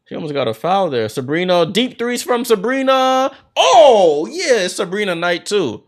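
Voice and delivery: brisk live game call, names punched; play-by-play. Acted. Commentator calling the game for viewers, in lively play-by-play.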